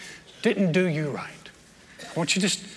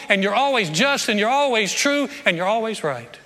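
ringing, louder prayer full of conviction; fervent prayer; 5 semitones higher, 4 dB louder than usual. Shown as a demonstration. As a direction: pray it out with bold confidence. Models a humble, confident prayer.

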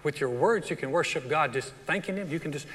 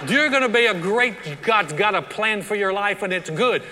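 put-on louder, upbeat blessing over the kids; loud encouragement; 4 semitones higher, 6 dB louder than usual. Shown as a demonstration. Parent speaking hope over their kids, loud and upbeat. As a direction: cheer your children on. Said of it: "look at your kids and go".

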